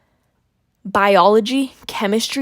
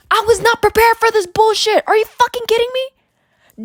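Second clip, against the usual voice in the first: outraged; 10 semitones higher, 4 dB louder than usual. Real, not acted. Outraged rant about workload.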